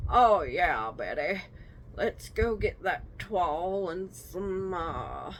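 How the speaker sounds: exaggerated Deep South accent; Deep South Colonel Sanders. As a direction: play an old-time Deep South porch gentleman, stretching the words. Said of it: "like, a Deep South Southern s-, Colonel Sanders".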